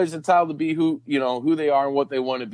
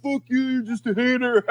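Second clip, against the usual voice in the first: mock snippy retort in a much higher voice; dismissive retort; 8 semitones higher than usual. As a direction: toss it off, sneering and high. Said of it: "just in the back of my mind".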